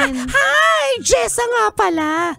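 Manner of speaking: high, slow flirty voice. Acted. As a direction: pitch way up to flirt. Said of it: "kaya ay, tumataas".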